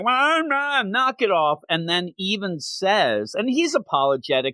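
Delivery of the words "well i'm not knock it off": higher voice, a mild hint of an annoyed little girl; indignant girl. Daughter telling her dad to knock it off, mildly indignant and higher. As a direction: lightly play the girl brushing her father off. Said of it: "And she's like".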